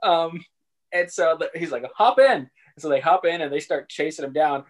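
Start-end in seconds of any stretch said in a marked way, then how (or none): 1.9-2.5 high, loud mock eager call; hammed-up come-aboard call. Major urges them aboard.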